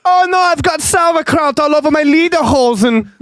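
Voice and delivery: very high hammed-up German, laughing through it; squeaky German voice cracking into laughter; comic German accent with a laugh; mock German accent. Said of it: "that fucking German accent".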